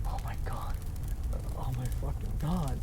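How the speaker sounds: horrified whisper. Acted. Collapsing in despair.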